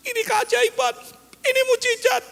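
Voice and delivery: high-pitched imitation of an amazed caller. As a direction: announce the miracle in a high put-on voice. Retold caller announcing a miracle. (tiruan suara takjub bernada tinggi)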